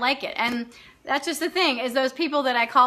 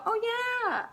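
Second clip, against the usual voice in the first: fake agreeable; 9 semitones higher, 8 dB quieter than usual. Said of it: "I'm not gonna go".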